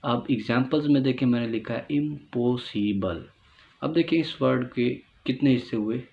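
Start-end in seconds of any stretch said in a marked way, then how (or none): 1.9-3.2 इम्पॉसिबल in four separate beats, इम, पॉ, सि, बल; four-part split. Shown as a demonstration. Teacher reading out the example इम्पॉसिबल, divided into syllables. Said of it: "चार हिस्से हुए".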